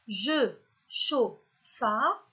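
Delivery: JE, CHAUD, FA, each separated by a pause; syllable-order test. Shown as a demonstration. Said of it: "première syllabe". (« je, chaud, fa », syllabes détachées une à une)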